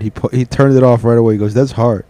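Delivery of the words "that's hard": ordinary delivery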